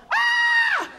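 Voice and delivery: high piercing mock scream. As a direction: shriek at a cockroach. Wife screams at a cockroach.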